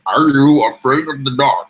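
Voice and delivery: slow, loud, put-on show-title announcement; pinball voice. Co-host quoting a spooky kids' show title, pinball voice. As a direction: intone it slowly and loudly in a put-on voice. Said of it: "Just doing my pinball voice".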